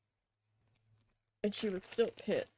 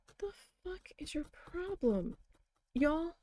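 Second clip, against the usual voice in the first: high, whispery retold retort; softened mock outrage, mostly whispered; hushed exasperation; 7 semitones higher, 4 dB quieter than usual. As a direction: say it under the voice, as an incredulous comeback. Retold exasperated reply to screaming mother.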